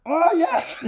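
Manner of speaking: very high squeal of dog delight; comic high-pitched overexcited dog; ecstatic Malinois. Acted. Malinois being patted by a guest, high ecstatic voice. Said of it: "then my Malinois goes".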